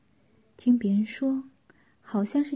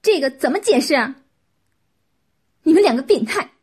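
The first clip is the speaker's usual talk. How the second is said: loud, high outraged shouting; angry scolding; 4 semitones higher, 10 dB louder than usual. A narrator's reading. Qin finding the evidence in their room, shouting abuse at both. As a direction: berate them, climbing to a shouted insult. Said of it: "秦骂道".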